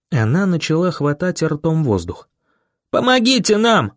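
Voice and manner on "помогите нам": high desperate cry; yelling for help. A narrator's reading. Boy yells on the run for help, yelling. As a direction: call out across empty streets. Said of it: "на бегу я орал".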